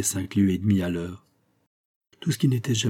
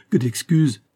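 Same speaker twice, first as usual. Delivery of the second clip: stammering apology; 5 semitones higher, 5 dB louder, slower than usual. A narrator's reading. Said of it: "balbutia-t-il".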